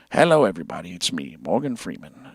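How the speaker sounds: low, soft, slowish narrator voice; Morgan Freeman impression. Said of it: "make videos like".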